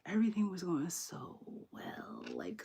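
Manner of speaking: whispered, the second part slowed right down; breathy whispered aside. Real, not acted. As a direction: hush it like a secret. Gushing about how well it went.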